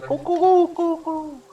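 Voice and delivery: high hooting owl cry, coo coo; owl call. Acted. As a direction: hoot like an owl to signal the others. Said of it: "an owl call come out of his mouth".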